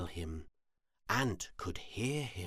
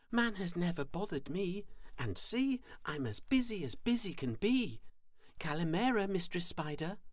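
high-pitched, brisk little ant voice; busy little ant; 11 semitones higher, faster than usual. A narrator's reading. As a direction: speak high and quick as a tiny ant. Ant talks in the story.

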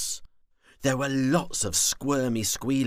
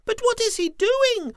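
very high squeaky little worm; small worm voice; 8 dB louder than usual. Acted. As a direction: ask like a tiny curious worm.

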